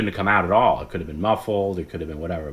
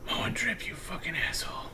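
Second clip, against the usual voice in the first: high whispered grumble; mock sleepy whisper; sleep-talk whisper; 10 semitones higher, 10 dB quieter than usual. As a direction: hiss it like a sleeper's curse. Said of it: "he's like".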